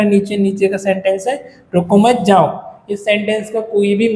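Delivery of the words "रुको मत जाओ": "रुको मत जाओ" run together without a break; no stop anywhere inside "रुको मत जाओ"; no pauses. Shown as a demonstration. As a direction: read "रुको मत जाओ" as one unpunctuated string. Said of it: "क्योंकि ना इसमें हाफ स्टॉप है".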